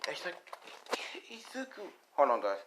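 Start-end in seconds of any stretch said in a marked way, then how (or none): 1.3-1.9 tiny high murmur of grief; grieving mother. Acted. Mother after the cop's news, grieving. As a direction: say the name very softly and high.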